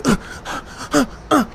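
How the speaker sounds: short effortful huffs; mock running pants. [name] running backstage for Katie, panting huffs. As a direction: huff as if sprinting.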